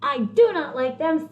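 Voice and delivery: high, slow, crying wail; exasperated wail. Acted. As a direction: wail it out at the end of his rope.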